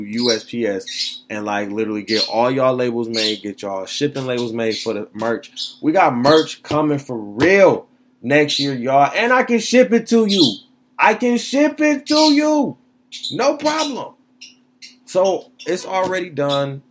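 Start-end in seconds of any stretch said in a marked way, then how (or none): 5.8-16.8 high, loud and hyped; excited. Real, not acted. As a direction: sell it with big energy. Hyped merch announcement.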